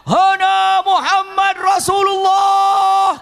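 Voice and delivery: high, slow booming cry; mighty shout. Retold or imitated. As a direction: roar it to a thousand men. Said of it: "Abbas teriak".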